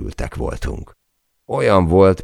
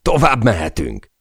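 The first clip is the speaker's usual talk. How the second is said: shouting; 7 semitones higher, 3 dB louder than usual. Acted. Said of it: "kiáltottam a fülébe".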